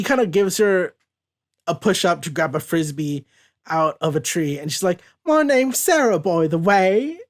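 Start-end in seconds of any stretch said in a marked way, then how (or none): 5.2-7.2 higher-pitched put-on English accent, slowing at the end. English woman introducing herself to Charles, put-on English accent. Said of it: "but it's an English one".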